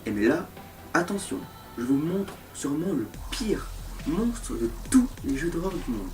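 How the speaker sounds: hushed suspense whisper. Acted. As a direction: whisper it like a ghost story. Whispered build-up to a jump scare.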